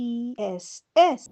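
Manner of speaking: ordinary talk, nothing marked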